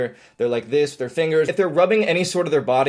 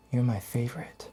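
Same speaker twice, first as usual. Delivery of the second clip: much softer, intense and earnest; creepy sincere; 2 semitones lower, 8 dB quieter than usual. Shown as a demonstration. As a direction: lean in and mean it a bit too much. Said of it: "when I'm facing you and I'm like".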